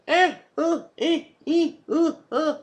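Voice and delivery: high, strained mock moaning of a bad actress; squeaky imitation moans, way up high; mock orgasm moans.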